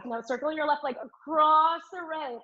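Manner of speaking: long, emphatic "across" mid-instruction; stress on across. Real, not acted. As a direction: lean hard on the word "across", stretching it. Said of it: "I'm stressing the word across".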